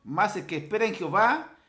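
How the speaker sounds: high, emphatic pulpit reading; forceful preacher quoting the psalm; proclaiming scripture. Acted. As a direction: declaim the verse in measured phrases.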